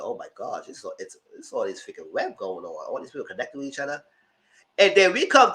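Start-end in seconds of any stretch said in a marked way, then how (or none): 0.0-4.0 low gossipy aside; hushed oh my gosh. Retold or imitated. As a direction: lower and softer, a conspiratorial murmur. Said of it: "I said".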